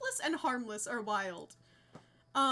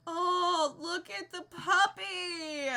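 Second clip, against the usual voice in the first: tender; 6 semitones higher, 5 dB louder, much slower than usual. Real, not acted. Sees the puppy.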